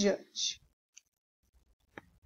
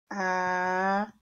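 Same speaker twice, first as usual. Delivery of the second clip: drawn-out words, spoken while writing; slow dictation; 4 semitones lower, 4 dB quieter, much slower than usual. Shown as a demonstration. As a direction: dictate it slowly, syllable by syllable. (ditado bem devagar)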